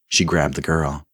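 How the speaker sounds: usual speaking voice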